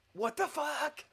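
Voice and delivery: high squeaky exclamation; baffled disbelief. Real, not acted. Player baffled at dying of thirst, high disbelief.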